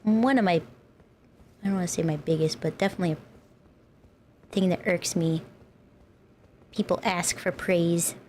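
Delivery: ordinary talk, nothing marked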